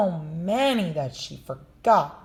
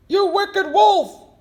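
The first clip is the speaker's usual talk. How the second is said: very high, loud and slow accusation; angry hunter; 8 dB louder, slower than usual. Acted. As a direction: spit it at the wolf. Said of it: "said the hunter".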